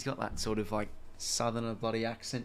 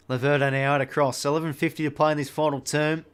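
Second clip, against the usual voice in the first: louder brisk footy commentator call; play-by-play commentary; 5 dB louder than usual. Acted. Gamer calling the final term of his footy match, louder commentary. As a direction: call the last quarter like TV commentary.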